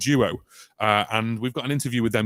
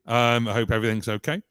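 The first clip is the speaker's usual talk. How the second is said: ordinary talk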